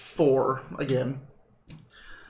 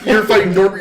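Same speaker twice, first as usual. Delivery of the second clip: ordinary delivery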